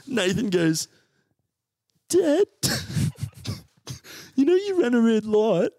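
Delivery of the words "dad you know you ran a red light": put-on kid brother voice. Kid brother asking cheekily.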